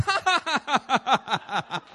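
loud, hammed-up laughing; happy laughter. Playing Jesus amused by religious leaders, happy laughing. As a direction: laugh it out in a merry run. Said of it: "and he's happy".

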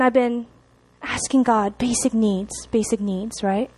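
normal talking voice

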